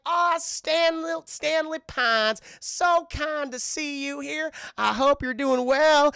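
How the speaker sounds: high, slow, syrupy put-on voice; loud, drawn-out fake politeness; Lil Gideon. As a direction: greet a rival with exaggerated sweetness. Voices Lil Gideon greeting Stan.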